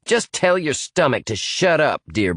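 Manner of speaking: barked. Acted. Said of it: "barked Cheyenne".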